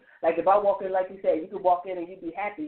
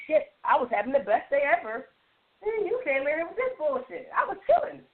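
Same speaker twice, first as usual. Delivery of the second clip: mock annoyed rant; 8 semitones higher than usual. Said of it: "And it's just like".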